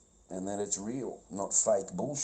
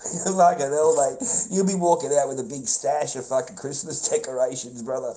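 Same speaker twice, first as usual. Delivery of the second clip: still laughing; 4 semitones higher, 8 dB louder than usual. Real, not acted.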